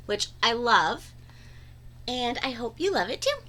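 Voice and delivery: usual speaking voice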